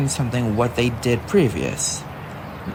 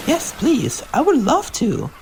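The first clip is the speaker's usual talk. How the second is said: high, bright eager acceptance; eager yes; 11 semitones higher than usual. Acted. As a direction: accept happily.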